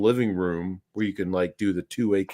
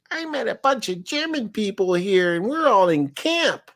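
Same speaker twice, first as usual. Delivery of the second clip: light wife impression; 10 semitones higher than usual. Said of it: "she's like".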